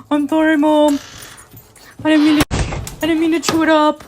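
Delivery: high, small puppy voice, pleading; voicing the dog. Owner voicing her dog apologizing to Mom. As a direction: speak for the dog as a sorry little pup. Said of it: "He's like".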